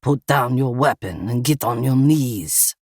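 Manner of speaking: Russian gunman giving a controlled order; thick Russian accent. A narrator's reading. Russian gunman orders Eva to disarm, thick Russian accent. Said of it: "a man with a thick Russian accent".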